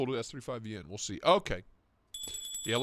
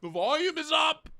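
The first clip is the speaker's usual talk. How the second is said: high protest; 13 semitones higher than usual. Real, not acted. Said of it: "The volume's up".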